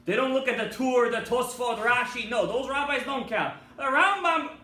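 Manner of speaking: loud, high scornful sarcasm; mock their shortcut; indignant preaching. Real, not acted. Preacher mocking lenient people.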